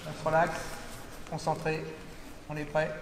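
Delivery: soft, low calming voice; quiet coach voice before the drill. Real, not acted. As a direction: settle the students down gently. Calming the class before exercise.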